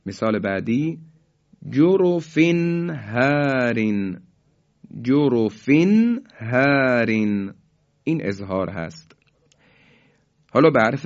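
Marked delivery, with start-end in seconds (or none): 1.7-7.5 slow recitation, nun sounded clearly with no hold; Quranic recitation, clear izhar. Shown as a demonstration. Teacher reciting the correct examples, slow clear izhar. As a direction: recite slowly and pronounce the nun cleanly.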